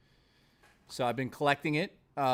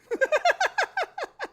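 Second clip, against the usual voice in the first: loud squealing laugh; high burst of laughter; 6 dB louder than usual. Real, not acted. Cracking up at own joke.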